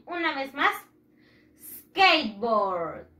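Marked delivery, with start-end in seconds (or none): 1.9-3.1 SKATEBOARD as two slow English syllables, "skeit-bord", falling low; English pronunciation model. Shown as a demonstration. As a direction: model SKATEBOARD for repeating, drawn out and dropping in pitch. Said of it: "One more time".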